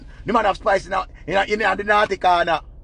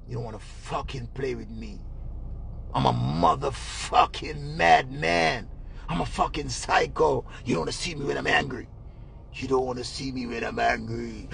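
angry man; 12 semitones lower, 9 dB quieter than usual. Retold or imitated. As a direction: imitate a madman threatening in a deep, soft voice. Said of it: "what kind of angry man this".

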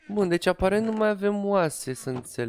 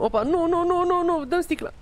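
high, quick panicked no no no; startled rising protest; sudden alarm; 10 semitones higher than usual. Real, not acted. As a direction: yelp in alarm at a near mistake. Nearly loses the glass.

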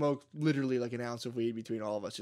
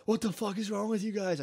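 high-pitched mock panic; retold freak-out; 8 semitones higher than usual. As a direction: act out freaking out at friends. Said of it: "I started freaking out at them".

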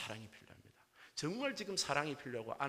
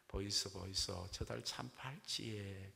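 put-on Gyeongsang accent bride; slower than usual. Gyeongsang bride copies Seoul bride's moon line, slow Gyeongsang accent. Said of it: "경상도 신부가 흉내냅니다".

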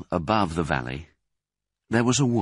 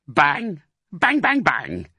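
bang punched higher and louder; mock gunshots; 9 semitones higher, 4 dB louder, slower than usual. Bean acting out shooting the fox, punchy bangs. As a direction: fire each bang like a shot.

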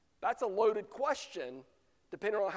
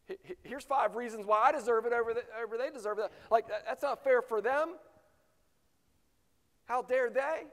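louder, faster, higher griping protest; mock-indignant disciple arguing his case; 4 semitones higher, 3 dB louder than usual. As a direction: rattle off your grievances, offended. Disciples' heated protest voiced.

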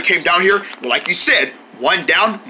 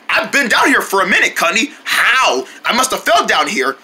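usual speaking voice

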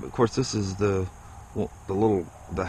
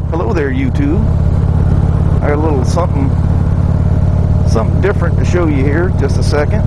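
talking loud over road and wind noise on a moving motorcycle; raised voice over wind; 2 semitones higher, 12 dB louder than usual. Real, not acted. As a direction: project the voice over the engine and wind. Talking while riding motorcycle in wind.

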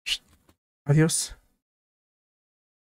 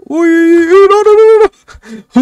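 shrieking, much higher, louder uy and no no; sudden high-pitched panic; panicked squeal; 11 semitones higher, 6 dB louder, slower than usual. Real, not acted. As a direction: yelp as you nearly fall off. Nearly falls off the obby.

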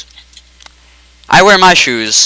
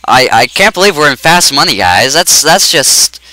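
ordinary talk, nothing marked

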